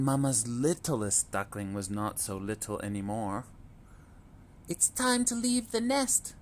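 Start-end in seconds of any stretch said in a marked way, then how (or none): 4.7-6.3 a mother's high voice, much higher; a mama duck telling her babies it's time; gentle mother duck. A narrator's reading. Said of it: "she said one day".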